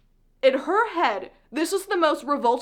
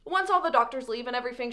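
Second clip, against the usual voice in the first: ordinary talk, nothing marked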